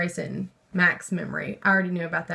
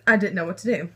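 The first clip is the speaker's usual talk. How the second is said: ordinary delivery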